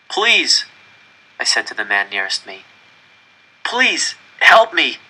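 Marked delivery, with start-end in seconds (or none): 0.1-0.6 high, pleading cry; desperate plea. A narrator's reading. Begging a stranger for help. 3.6-5.0 high, loud begging; desperate plea. A narrator's reading. Begging a stranger for help.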